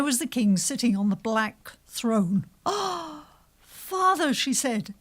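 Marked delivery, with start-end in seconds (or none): 2.6-4.3 put-on high, pleading young voice; daughter's plea. A narrator's reading. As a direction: lift up into a daughter begging. Daughter pleads with angry king.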